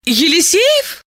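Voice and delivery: high puzzled rise; puzzled question. Acted. Diana voice, high.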